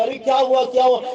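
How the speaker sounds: high, loud panicked call from the kitchen; alarmed mother. Acted. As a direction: cry out like a startled mother. Mother hears child fall.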